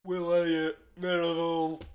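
mock Hull accent, drawn-out vowels. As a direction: do a cheeky impression of a Hull local. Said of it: "I do not endorse that impression".

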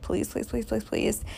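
hushed, rapid little plea; praying. Retold or imitated. Patient hoping to hear blood flow, quiet praying. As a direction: plead softly under your breath, re-enacting a prayer. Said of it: "praying, like".

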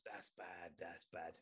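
very soft, laughing tut; mock disapproving mutter. Streamer mimics reacting to dirty jokes, very soft mock disapproval. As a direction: hush it like a guilty groan. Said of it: "me laughing at ... and going".

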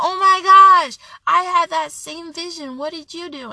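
high, loud burst of astonishment, easing off; amazed reaction. Acted. Onlooker to someone prospering with the same vision, amazed.

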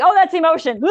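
hammed-up shock at a feeling; mock alarm. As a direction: pretend horror at an emotion. Wall recoiling at emotion.

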